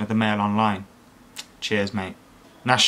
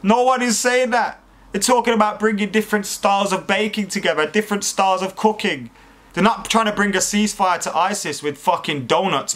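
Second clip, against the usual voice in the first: high-pitched exasperated retort; 6 semitones higher than usual. Real, not acted. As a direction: protest in disbelief, pitched up.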